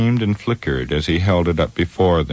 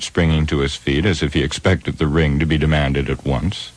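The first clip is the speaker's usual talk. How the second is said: plain reading voice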